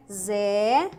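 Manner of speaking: "Zero" split into a long open "zé" and "ro"; syllable breakdown. Shown as a demonstration. Teacher explaining the open E symbol, split syllables. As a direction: pause after the rising "zé" of "Zero". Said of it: "Zé".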